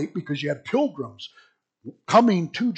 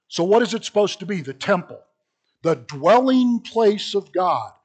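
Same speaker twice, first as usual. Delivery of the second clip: usual speaking voice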